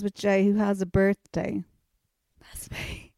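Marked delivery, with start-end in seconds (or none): 2.4-3.1 mock whispered reply. Mock eager reply to psychic.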